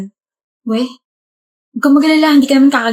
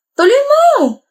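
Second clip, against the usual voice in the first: angry demand; 13 semitones higher, 3 dB louder, much slower than usual. Acted. Girlfriend demands he finish.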